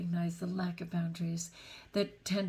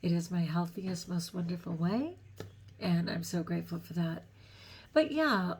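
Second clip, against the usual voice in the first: ordinary talk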